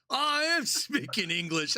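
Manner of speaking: high, squeaky put-upon protest; indignant friend. Retold or imitated. Scottish friend protesting he speaks English, high and indignant. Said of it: "retorts, friend voiced".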